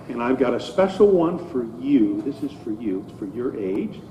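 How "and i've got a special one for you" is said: usual speaking voice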